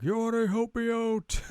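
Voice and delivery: high-pitched put-on character voice; townsperson asking help. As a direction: call over in a high cartoonish voice. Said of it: "this other guy's like".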